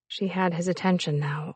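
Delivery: ordinary delivery, nothing marked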